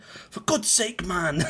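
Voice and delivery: loud, high-pitched retold exasperation; exasperated outburst. Goalkeeper retelling his teammate's outburst after a caught lob, exasperated. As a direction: imitate an exasperated teammate crying out. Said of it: "he just looked at me and went".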